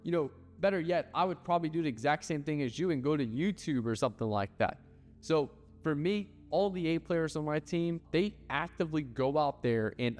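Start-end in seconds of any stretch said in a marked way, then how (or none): 0.0-4.8 mock lecture. Boss lecturing employee, high voice.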